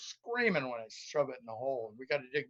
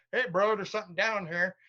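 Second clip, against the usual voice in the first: detector talking; 6 semitones higher, 4 dB louder than usual. Pretend. Detector voiced as a person.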